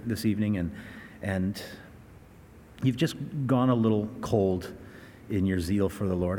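ordinary talk

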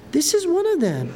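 high-pitched pointed accusation; single him out, pitched well up; accusing servant girl. A narrator's reading. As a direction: read as a high-voiced accuser. Servant girl accuses Peter in reading.

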